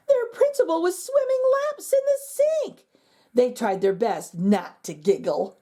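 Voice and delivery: ordinary delivery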